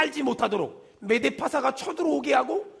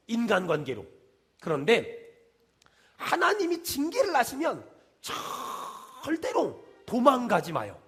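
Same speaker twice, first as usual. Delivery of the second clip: ordinary talk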